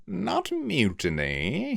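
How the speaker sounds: slow, level, icy correction; icily calm. A narrator's reading. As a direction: calmly correct the captain. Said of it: "remained icily calm".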